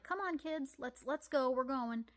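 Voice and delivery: put-on light sing-song call to the kids; herding hens. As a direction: coax the chickens along brightly. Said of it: "I'm like".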